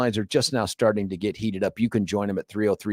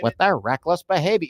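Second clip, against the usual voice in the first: lightly mimicked higher politician voice; mimicking a candidate; 5 semitones higher than usual. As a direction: echo the line in a raised voice. Mimics clip just played.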